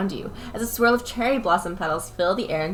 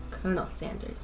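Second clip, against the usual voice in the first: quiet breathy seductive man; sexy man voice; 10 dB quieter than usual. Acted. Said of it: "I need a sexy man voice".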